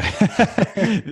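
quick high laugh at his own joke; short amused laugh. Real, not acted. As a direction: let a brief laugh slip out.

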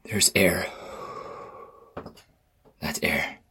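whispered with relief, out of breath; breathless whisper. Real, not acted. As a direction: whisper it, gulping air. Gasping after removing mask.

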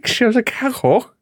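very high mimicked Scouse; Scouse accent. Host copying the co-host's Scouse demo, high Scouse impression. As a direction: echo it back in mock Scouse. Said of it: "wanna be a Scouser".

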